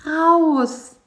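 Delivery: high, eager insect voice. A narrator's reading. Bisi dreaming of parties, high and eager. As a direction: push excitedly, pitched high.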